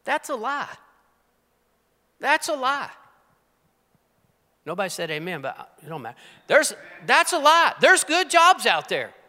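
high, loud fervent preaching; preaching at full force. Real, not acted. A preacher rallying the congregation, loud and fervent. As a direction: drive it home to the congregation.

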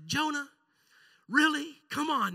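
high, disbelieving rebuke; a weary parent scolding a sulking child; exasperated God. Retold or imitated. God chiding Jonah over the lost vine, high and incredulous. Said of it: "And God said".

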